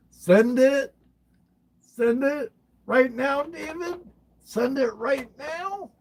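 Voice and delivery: high, eager push to send it; high excited call to hit send; excited urging. Real, not acted.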